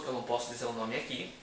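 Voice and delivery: ordinary delivery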